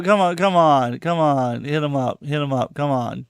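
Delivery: ordinary delivery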